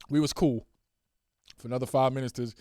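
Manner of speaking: normal talking voice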